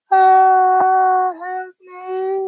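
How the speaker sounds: very high, loud hammed-up shriek for help; mock terrified scream. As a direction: scream like a frightened girl in a horror film. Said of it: "my response was kind of like this".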